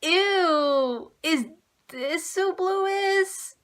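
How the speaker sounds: mock disgusted fan; disgusted disappointment. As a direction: drag it out like you smelled something bad. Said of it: "but I was like".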